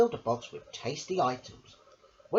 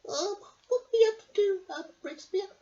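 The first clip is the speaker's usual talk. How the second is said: much higher, squeaky little lady voice; Missus Armitage voice; 4 dB louder than usual. Acted. Missus Armitage asks her dog a question, high and squeaky. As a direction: pipe it up like a fussy little lady. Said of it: "said Missus Armitage".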